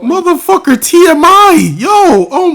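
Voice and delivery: a real startled scream of outrage; shocked scream. Real, not acted. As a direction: shriek it out like you just heard way too much. Shocked reaction to overshare.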